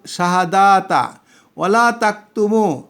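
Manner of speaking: ordinary delivery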